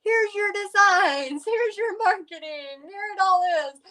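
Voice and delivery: a bright, high rescuer's voice; cheerful helper. Pretend. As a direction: play the eager helper offering everything. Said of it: "somebody like me at that moment that could have been like".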